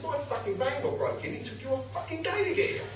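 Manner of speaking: high-pitched, soft-voiced exasperation; retold indignation. Retold indignant complaint to late copper.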